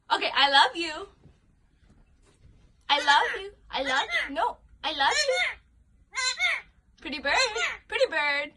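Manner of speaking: ordinary talk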